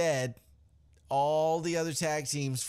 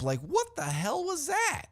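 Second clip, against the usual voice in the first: incredulous. Real, not acted. Baffled by the chaotic brawl.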